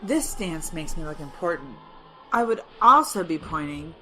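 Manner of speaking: usual speaking voice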